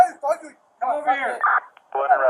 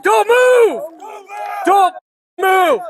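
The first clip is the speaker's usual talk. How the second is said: shouted police commands; 5 semitones higher than usual. Real, not acted. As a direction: yell it straight at the suspect. Officer yelling at suspect after shots.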